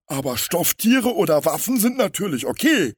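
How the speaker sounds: high, loud crook making a concession; pitying villain. A narrator's reading. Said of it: "ergänzte der Große". (hohe Schurkenstimme, einlenkend)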